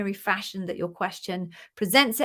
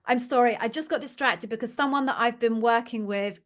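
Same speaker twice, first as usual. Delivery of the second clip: normal talking voice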